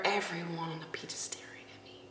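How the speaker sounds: whispered aside. Real, not acted. Telling viewers everyone is staring, whispered. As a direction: hush it like a secret. Uneasy on the beach.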